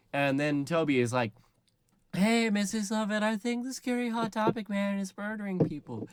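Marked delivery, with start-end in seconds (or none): 2.1-6.1 high-pitched put-on kid voice; mock little boy raising an alarm; child's voice. Toby warning Missus Lovett about a murderer, childlike.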